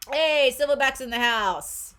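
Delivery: loud, high, drawn-out greeting; excited shout-out. Real, not acted. Reader hailing someone who joins, excited shout-out. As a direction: call it out to welcome an arrival. Greets viewer joining the live stream.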